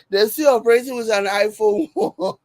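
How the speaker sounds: breaking into laughter mid-sentence; cracking up. Real, not acted. Reading a viewer's joke aloud, cracking up. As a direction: read it while cracking up. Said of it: "get me cracking up".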